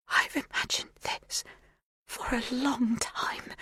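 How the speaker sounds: slow, faint whisper; barely audible. A narrator's reading. Magda barely whispering her long-held wish. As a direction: breathe it almost silently. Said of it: "so quietly I barely catch her words".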